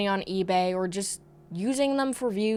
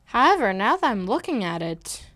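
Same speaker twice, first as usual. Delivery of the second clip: normal talking voice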